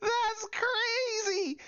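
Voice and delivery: high, cracking up mid-sentence; helpless laughter breaking through drawn-out words; real high-pitched laughing amazement; laughing in disbelief. Real, not acted. Cracking up at the broken glass.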